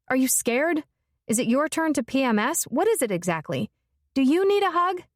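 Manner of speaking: mocking. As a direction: taunt him with exaggerated concern.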